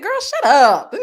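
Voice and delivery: loud retold outburst, laughter behind it; emphatic shushing. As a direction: hit it loud, no polite hush. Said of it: "I ain't say no hush".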